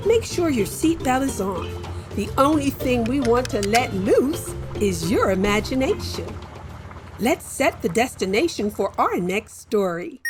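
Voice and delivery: ordinary delivery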